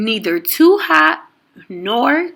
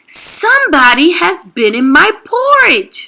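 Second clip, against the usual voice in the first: indignant Papa Bear; 8 semitones higher, 5 dB louder than usual. A narrator's reading. Papa Bear discovers porridge.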